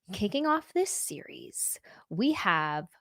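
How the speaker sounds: spooky voiceless whisper. Acted. Introducing the haunted venue series, an eerie whisper. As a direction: hush it to build suspense.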